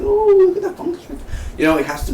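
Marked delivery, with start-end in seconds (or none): 0.0-1.0 high cooing mock voice; high-pitched playful cooing; silly high voice. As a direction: coo at the fungus like a baby.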